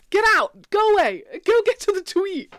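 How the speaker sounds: loud, high mock shooing; playful shooing. Real, not acted. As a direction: shoo them away laughing.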